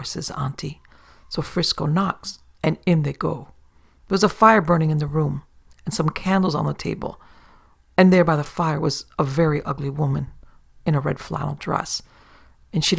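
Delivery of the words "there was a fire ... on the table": ordinary delivery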